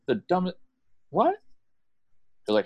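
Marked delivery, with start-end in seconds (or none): 0.1-1.4 high-pitched, baffled reaction; astonished, pitched way up; acted incredulity. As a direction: sputter in mock disbelief, breaking off. Acted reaction to silly argument.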